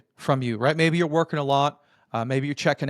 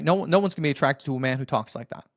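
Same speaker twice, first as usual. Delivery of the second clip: normal talking voice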